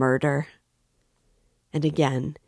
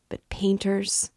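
slow, breathy whisper; 6 semitones higher, slower than usual. A narrator's reading. Raskolnikov feigns searching memory, whispered. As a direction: muse it under your breath.

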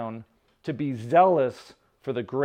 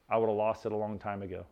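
normal talking voice

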